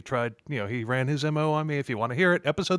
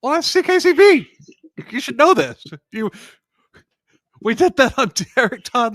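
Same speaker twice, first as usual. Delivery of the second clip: loud; 9 semitones higher, 9 dB louder than usual. Real, not acted. Loud retort, laughing.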